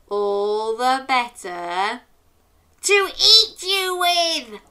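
slow, then a high loud pounce on eat; big bad wolf. The wolf about to gobble the girl, slow then loud. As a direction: build and snap up on the last words.

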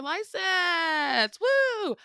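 high, drawn-out triumphant whoop; celebratory cheer. Real, not acted. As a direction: stretch it out and cheer loudly. Celebrating the pilot's license.

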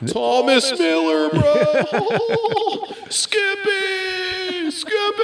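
squeaky high yelled name; high silly shout. Acted.

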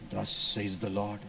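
low, slow, booming "Thus says the Lord"; deep preacher voice played for a laugh; mock prophetic voice. Shown as a demonstration. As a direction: ham up the holy pronouncement. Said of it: "suddenly they have a voice modulation".

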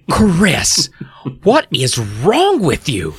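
high, drawn-out motherly scolding; acted exasperated rebuke; demanding. A narrator's reading. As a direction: demand an answer slowly as an appalled mother. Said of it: "Missus Powell demanded".

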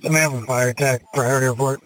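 normal talking voice

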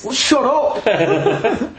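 high, drawn-out laughing "shut up"; mock exasperation. Real, not acted. Mock scolding of interrupting co-hosts.

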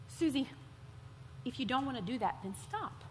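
soft, low, calm and firm; a quiet voice inside her; hint of a gentle divine voice; gentle inner voice. Holy Spirit speaks inside her.

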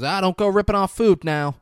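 playful loud scold; teasing warning. Retold or imitated. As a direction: wag a finger with your voice.

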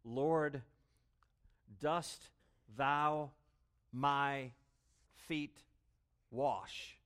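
halting, one word at a time with long pauses; slow broken-up Greek word order; choppy word-by-word rendering. Shown as a demonstration. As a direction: read it word by word like a stiff literal translation. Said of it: "choppy rendering of the original".

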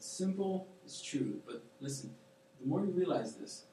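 ordinary talk, nothing marked

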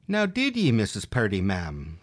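usual speaking voice

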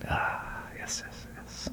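soft, airless whispered yeses; whispered mock reverence. Chocolate expert answering the host in a whisper. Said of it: "let's just take it down a notch".

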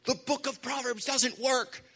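high-pitched retelling of his own frustrated complaint; mock-despairing cry, pitched high; exasperated complaint. Preacher retelling complaining to a pastor friend, high and exasperated. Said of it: "I just looked at him and I said".

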